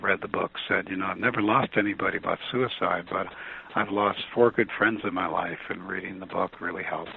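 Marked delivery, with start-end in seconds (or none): none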